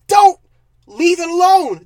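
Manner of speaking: DON'T punched high and loud, then a firm finish; exasperated outburst. Real, not acted. Reviewer scolding the filmmakers, exasperated and loud. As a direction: hit don't hard, fed up.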